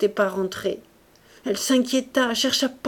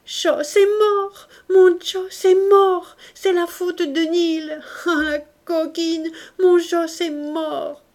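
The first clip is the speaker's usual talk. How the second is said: high, slow wailing cry, much higher and louder; anguished scream; 9 semitones higher, 5 dB louder, slower than usual. A narrator's reading. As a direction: wail it out like a mother gone mad. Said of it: "Elle cria".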